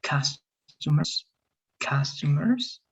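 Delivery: customers, stretched out slowly; slow model word. Shown as a demonstration. Teacher correcting a student's word, slowly. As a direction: say customers slowly with a clear first syllable. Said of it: "a little bit more like".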